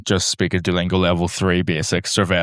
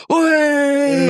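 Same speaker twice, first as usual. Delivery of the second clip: high shouted oi; mock shout; 4 dB louder than usual. Dancing man turning on the Irish lads, high yelled oi. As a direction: bark it up high like a cheeky yell. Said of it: "he just goes".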